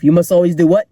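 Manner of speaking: normal talking voice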